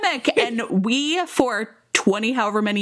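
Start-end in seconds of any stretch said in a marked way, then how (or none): 0.0-0.4 street dealer call. Retold or imitated. Friend mimics a Wire dealer, high hawking call. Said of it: "And they'd be like".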